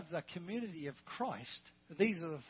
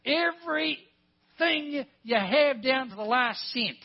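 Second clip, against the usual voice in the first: loud, slow, higher-pitched preaching; emphatic preaching; 7 semitones higher, 12 dB louder, slower than usual. Real, not acted. Preacher pressing the congregation on giving up everything, emphatic preaching. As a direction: drive each word home loudly and slowly.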